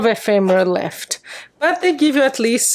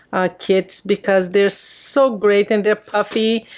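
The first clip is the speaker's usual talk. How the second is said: usual speaking voice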